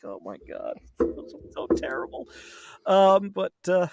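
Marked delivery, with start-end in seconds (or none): 0.0-2.3 hushed dismay. Retold or imitated. Cringing reaction to bad accent.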